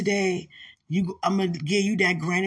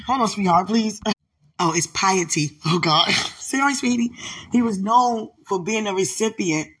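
ordinary talk, nothing marked

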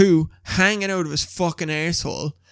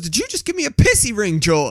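high, louder imitation of outraged disbelief; indignant girlfriend; 7 semitones higher, 5 dB louder than usual. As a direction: demand an answer, mimicking her outrage. Acting girlfriend's indignant question.

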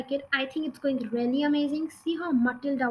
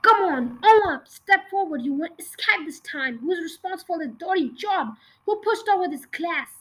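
loud and high, hammering the class; roaring demand; 5 semitones higher, 5 dB louder than usual. A narrator's reading. Headmistress roaring at the silent class for the culprit. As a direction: demand the culprit step forward. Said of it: "she roared".

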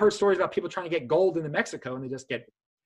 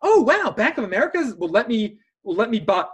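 higher-pitched mock amazement on the opening wow; lightly voiced naive customer, gushing; impressed bank customer; 4 semitones higher, 5 dB louder than usual. People imagined reacting to Bank of America's offer, mock impressed. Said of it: "people are gonna be like".

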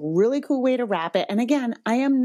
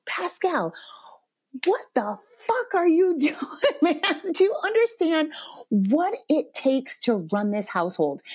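high, incredulous scolding; retold fury; 8 semitones higher than usual. Mom telling off her teenage son, furious. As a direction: demand an answer, voice pitched way up. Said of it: "furious in a way".

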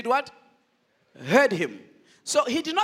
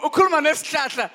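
mock incredulous, laughing; Peter laughing in Zulu; 5 dB louder, faster than usual. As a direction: tease him for talking to a tree. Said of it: "Peter was like".

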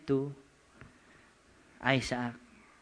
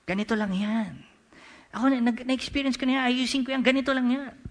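higher-pitched, brushing-it-off voice; self-reliant know-it-all; 8 semitones higher, 3 dB louder, faster than usual. Acted. Person doing it their own way, higher dismissive voice. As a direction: play someone sure they can fix it alone.

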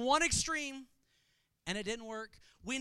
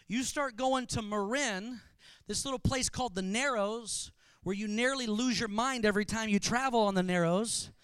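usual speaking voice

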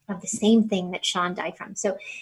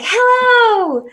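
a bright, much higher and louder hello; a cheerful mock greeting, loud and high; imitating Biden's hello; 11 dB louder than usual. Biden greets her warmly.

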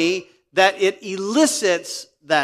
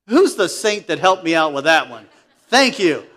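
usual speaking voice

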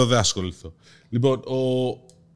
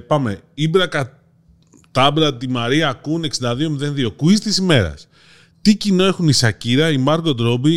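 normal talking voice

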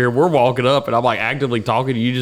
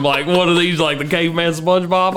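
ordinary talk, nothing marked

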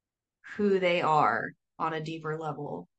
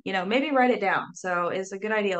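ordinary talk, nothing marked

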